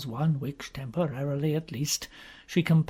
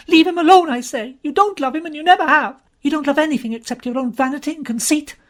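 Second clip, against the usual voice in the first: much louder, higher screaming at her; furious shouting; 13 semitones higher, 10 dB louder, faster than usual. Acted. Jean screaming at Uziette, much louder and higher. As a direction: scream it with everything you've got. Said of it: "She burst out".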